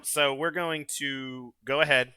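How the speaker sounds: usual speaking voice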